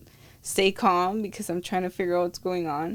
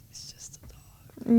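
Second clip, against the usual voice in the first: whispered; 4 semitones higher, slower than usual. Retold or imitated. Retold thought about daughter.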